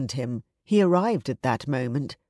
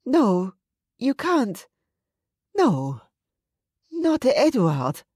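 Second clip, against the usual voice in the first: shocked disbelief; 8 semitones higher, slower than usual. A narrator's reading. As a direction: refuse to believe it, dragging the last words. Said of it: "after a minute of shocked silence".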